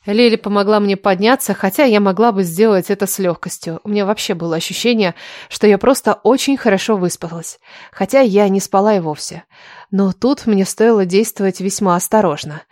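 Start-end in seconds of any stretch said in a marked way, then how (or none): none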